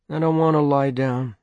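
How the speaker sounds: mildly whining, stubborn protest; sullen refusal. A narrator's reading. Sick boy refusing to lie down, sullen.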